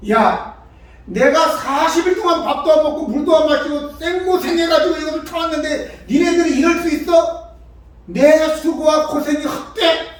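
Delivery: acted angry complaint; indignant outburst. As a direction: throw the hardship back at them in outrage.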